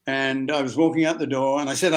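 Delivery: ordinary delivery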